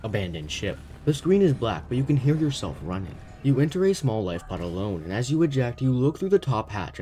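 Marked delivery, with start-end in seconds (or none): 0.0-0.8 lower, softer robotic ship alarm; male robot voice. Retold or imitated. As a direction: announce it like a failing ship computer. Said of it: "a male robotic voice says".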